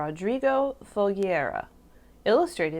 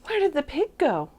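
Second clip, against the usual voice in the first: higher-pitched puzzled outcry; animals' anxious cry; 7 semitones higher than usual. A narrator's reading. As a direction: cry out, baffled by the disappearance. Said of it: "the animals cried".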